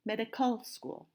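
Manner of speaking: medical school with CAL stressed in medical; wrong last-syllable stress. Shown as a demonstration. Teacher shows what we don't say, medical stressed on CAL. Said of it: "And we don't say".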